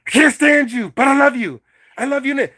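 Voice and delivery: a narcissist yelling mixed messages; mock yelling. Shown as a demonstration. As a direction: shout it at them, then claim love. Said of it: "if you're yelling at somebody".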